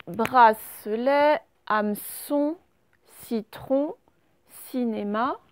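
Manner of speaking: bracelet said very slowly, each syllable drawn out; slow dictation. Shown as a demonstration. Teacher slowly sounding out bracelet for the class. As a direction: stretch bracelet so pupils hear the S. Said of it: "comment est-ce que l'on fait le son S".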